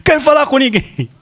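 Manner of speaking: put-on high, pouting kid's refusal; sulky child. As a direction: play the upset boy shutting everyone out. Son slams door, voiced by father. (menino emburrado e bem agudo)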